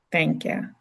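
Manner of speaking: ordinary delivery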